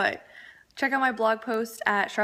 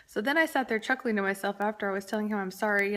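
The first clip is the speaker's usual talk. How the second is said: normal talking voice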